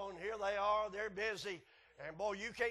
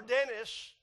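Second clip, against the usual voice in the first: holler; 5 semitones higher, 9 dB louder than usual. Retold or imitated. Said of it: "whenever they'd holler".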